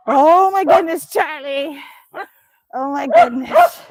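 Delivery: loud, slow, drawn-out delight; loud slow oh my goodness; excited exclaim. Real, not acted. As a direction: gush over the wriggling dog. Dog jumps around excitedly.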